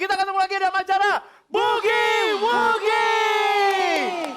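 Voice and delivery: slow, shouted show opening; show host shout. Acted. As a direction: boom out the welcome like a TV host.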